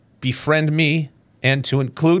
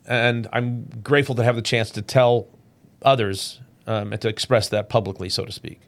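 ordinary talk, nothing marked

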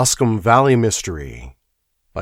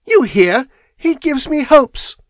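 much higher, urgent pleading; young woman, eager; 6 dB louder than usual. Acted. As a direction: convince him James is innocent. Miss Turner voiced in story.

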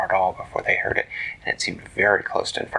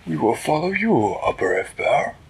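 higher, formal and polite small hero; Flippertigibbet voice; 7 semitones higher, 4 dB louder than usual. Acted. Flippertigibbet greeting the turtle, high and polite.

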